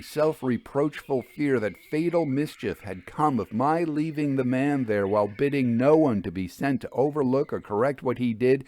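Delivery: ordinary delivery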